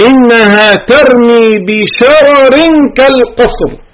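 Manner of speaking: ترتيل مرتفع وبطيء; Quran recitation. Shown as a demonstration. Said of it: "إنها أي النار ترمي بشرر".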